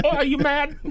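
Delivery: high, fast mock Australian accent; hammed-up Australian bloke voice; bad Australian accent. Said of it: "That's a really good accent".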